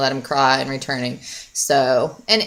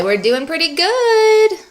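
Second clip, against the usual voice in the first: high, slow pretend baby voice; voicing the baby; 12 semitones higher, slower than usual. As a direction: speak for the baby. Voicing baby's answer.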